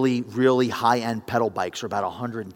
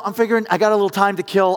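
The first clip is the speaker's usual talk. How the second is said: normal talking voice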